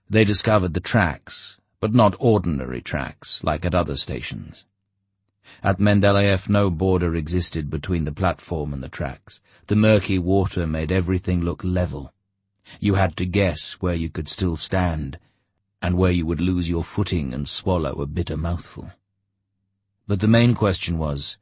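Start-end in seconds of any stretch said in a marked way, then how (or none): none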